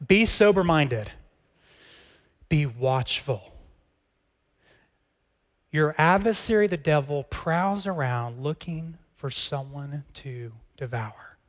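slow, weighty reading of the verse; measured, solemn scripture reading. Shown as a demonstration. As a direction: read each phrase slowly and deliberately. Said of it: "Let's read this verse slow".

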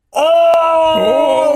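loud high stadium "oh"; crowd roar. Retold or imitated. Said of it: "todo el estadio así de". (rugido del estadio, fuerte y agudo)

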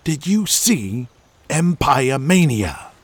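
drawn-out, slow question; slow it down for effect; slow, teasing. Acted. [name] slows down.

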